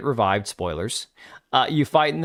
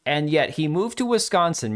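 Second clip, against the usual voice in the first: usual speaking voice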